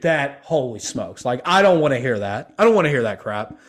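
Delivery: usual speaking voice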